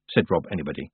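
ordinary delivery

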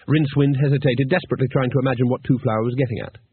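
usual speaking voice

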